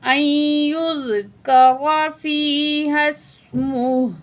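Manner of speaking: slow, drawn-out tajweed recitation; Quran recitation. Shown as a demonstration. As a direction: recite the verse with long held vowels. Quran verse recited slowly.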